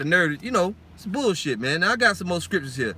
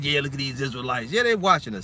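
mock devils; 5 semitones lower than usual. Voices watching devils.

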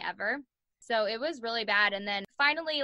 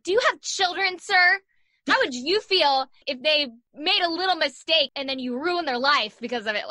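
raised, louder pleading plea; pleading with the cop; 5 semitones higher, 6 dB louder than usual. Retold or imitated. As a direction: beg the cop to think of his own kids.

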